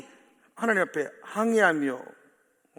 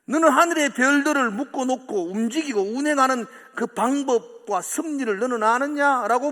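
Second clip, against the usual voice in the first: majestic questioning, building louder and higher; God questioning Job; 6 semitones higher, 7 dB louder than usual. Retold or imitated. Preacher voicing God questioning Job, building commanding. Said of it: "하나님 이렇게 하시거든요".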